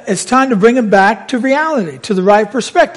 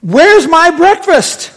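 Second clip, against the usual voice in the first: higher, drawn-out mock panicked wail; 7 semitones higher, slower than usual. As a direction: whine it slowly in pretend panic. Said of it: "they're panicking".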